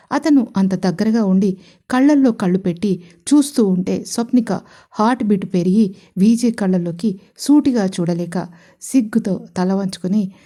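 ordinary delivery, nothing marked

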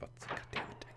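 breathy whispered curse under his breath. Real, not acted. Player failing at a game, whispered curse.

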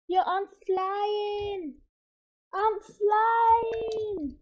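high, slow, half-sung excited shout. Real, not acted. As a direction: sing out the thrill of flying. Character suddenly flies.

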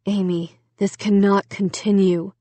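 mildly grave father's voice; solemn. A narrator's reading. Dad addressing his troubled daughter, solemn. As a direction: speak gravely, with restraint. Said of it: "Dad said solemnly".

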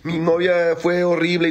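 mock a heartbroken man griping about his girlfriend; man whining to friends about his cheating girlfriend, mock whine; mock whiny guy. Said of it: "si nosotros salimos a decir".

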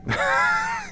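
shrill high laugh, half a cry; nervous yelp of a laugh; scared laugh. Real, not acted. Player caught by the monster again, laughing and crying out.

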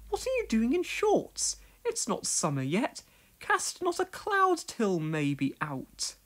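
put-on high prim older lady; a fussy village lady scolding lightly; Miss Hubbard voice. A narrator's reading. Miss Hubbard teases Pat about his shorts.

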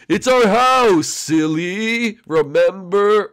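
high, loud cartoon ghost; sing-out, drawn-out playful reminder; cheery ghost friend. Ghost Blimpy reminds confused Luigi.

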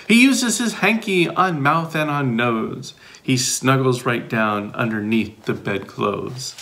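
put-on lively read-aloud for young listeners; bouncy picture-book reading; reading to children. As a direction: read it like story time for kids.

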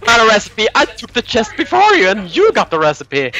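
high, loud accusation; worked-up complaint, voice up high; indignant. Real, not acted. Complains partner got the loot.

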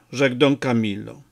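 ordinary delivery, nothing marked

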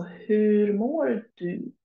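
"hur mår du" stretched very slowly, long vowels; slow model phrase. Shown as a demonstration. Teacher showing learners the question word hur, very slowly. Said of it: "the question word hur".

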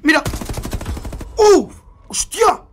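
sudden surprised outburst; startled. Real, not acted. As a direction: blurt it out in shock. Startled during gameplay.

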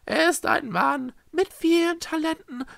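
high, loud swooning groan. A narrator's reading. Praise of the man who cooks, groaned. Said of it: "stöhnte Echo".

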